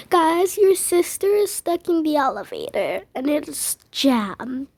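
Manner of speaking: ordinary delivery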